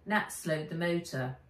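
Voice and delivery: usual speaking voice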